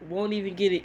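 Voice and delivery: usual speaking voice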